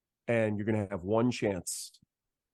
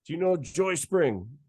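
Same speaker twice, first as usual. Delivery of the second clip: higher, lively voice of the bandleader on the phone; imitating Arturo; 6 semitones higher, 5 dB louder than usual. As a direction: lightly play the excited bandleader. Arturo quizzing songs.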